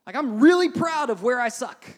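loud, emphatic proud declaration; mock boasting. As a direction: proclaim it like an absurd brag. Paraphrases Paul boasting of weaknesses.

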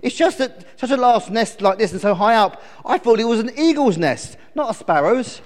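high, loud little-bird voice; perky storybook bird; chirpy sparrow. Acted. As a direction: explain as an eager sparrow.

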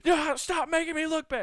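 hammed-up tantrum, building; mock DiCaprio rant. DiCaprio throwing a guest off his yacht, shrill tantrum. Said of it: "Just like".

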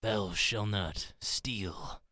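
slow, drawn-out solemn proclamation; booming commandment voice. Pretend. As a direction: intone it like a biblical voice. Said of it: "like the muffled tone voice".

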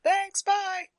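high quick goodbye; chirpy maiden. Acted. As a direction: toss off a bright farewell. Maiden waves off.

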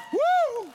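high whooping "woo"; cheering whoop. Real, not acted. Cheering a named audience member.

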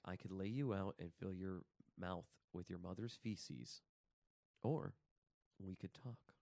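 low, quiet imitation of a chilling film Satan; understated threat, delivered softly and low; bone-chilling menace. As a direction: imitate a calm, chilling devil. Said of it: "He is absolutely bone-chilling in it".

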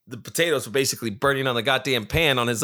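ordinary delivery

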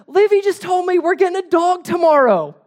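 very high, loud gleeful child imitation; super excited. Son telling dad what his sister said, super excited. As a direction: squeal the news like an overjoyed kid. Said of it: "super excited".

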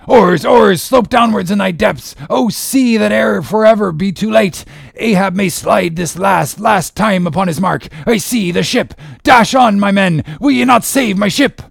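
high, loud impassioned pleading; desperate captain. Acted. Ahab urging his oarsmen to save his ship, desperate shout. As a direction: cry out urgently to the crew.

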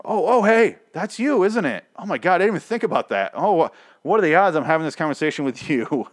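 high, loud, fake-casual probing of the crossbow guy; mock innocent accusation. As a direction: pretend you suspect nothing while pointing fingers. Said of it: "went exactly like that, word for word".

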